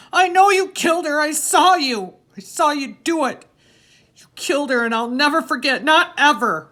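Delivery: high, loud, frantic accusation; screaming. A narrator's reading. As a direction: scream it over and over at the killer. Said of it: "keep screaming".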